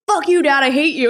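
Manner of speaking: high-pitched mock tantrum; retold anger. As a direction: act out a kid mad at a parent. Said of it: "mad at him".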